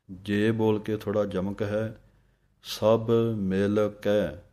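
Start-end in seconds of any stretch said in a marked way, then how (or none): 2.6-4.4 ਸਭ ਮਿਲ ਕੇ ਹੌਲੀ, ਹਰ ਸ਼ਬਦ ਵੱਖਰਾ; ਸਭ, ਮਿਲ, ਕੇ ਵੱਖ ਵੱਖ ਬੋਲੋ; slow, separate words. Shown as a demonstration. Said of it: "ਵੱਖਰੇ ਵੱਖਰੇ ਬੋਲਣੇ ਹਨ". (ਹੌਲੀ ਵੱਖਰਾ ਉਚਾਰਨ)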